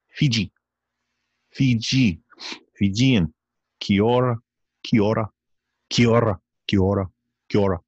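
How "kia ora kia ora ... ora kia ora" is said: Kia ora clipped fast, Kiwi style; Kia ora said quick. Shown as a demonstration. Host showing how Kiwis say Kia ora, quickly. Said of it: "They say it pretty quick".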